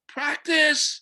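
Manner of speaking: put-on high child-like shout of the answer; imitating children calling out; kids yelling out. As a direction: call it out like an eager kid. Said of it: "yell out".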